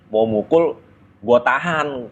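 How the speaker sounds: ordinary talk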